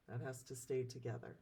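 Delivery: ordinary talk, nothing marked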